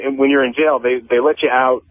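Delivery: ordinary talk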